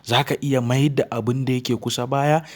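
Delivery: ordinary talk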